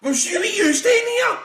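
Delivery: high, loud, slow laughing outburst; mock shout. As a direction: yell it out through laughter. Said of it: "I was like".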